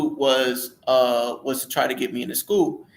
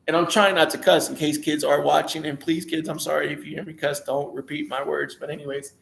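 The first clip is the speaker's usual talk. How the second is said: ordinary talk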